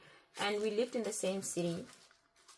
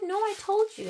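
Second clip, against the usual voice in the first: loud, very high mock protest; shrilly raised, forceful pushback; imitated gaslighting insistence; 10 dB louder than usual. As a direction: mimic his adamant denial. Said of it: "he tried to gaslight me".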